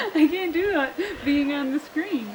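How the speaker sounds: giggling through the words; laughing. Real, not acted. Nervous woman laughing at the camera, giggling. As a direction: laugh it out, too shy to go on.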